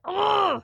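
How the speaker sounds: high, mock-frustrated groan of a child at the piano; a light imitation of a kid's exasperated ugh. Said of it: "he's like".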